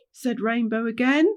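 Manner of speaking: ordinary delivery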